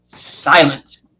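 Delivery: "silence" said low after a held pause; dramatic reveal. Shown as a demonstration. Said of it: "See how that sounds?".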